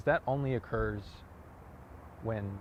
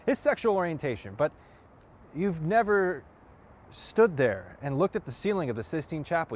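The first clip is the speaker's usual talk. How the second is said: raised, emphatic dramatic confrontation with long pauses; impassioned challenge, punching key phrases; forceful monologue; 2 semitones higher than usual. Acted. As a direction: push each point home with rising pitch and force. Same monologue, second take pushed harder.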